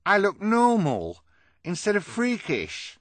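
high, loud, stretched-out delight; elated character voice, way up high; overjoyed. Acted. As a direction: exclaim it in joyful amazement. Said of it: "he said happily".

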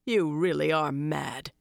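slow, rough, growling reproach; growled. Acted. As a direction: growl it at them slowly. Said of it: "he growled".

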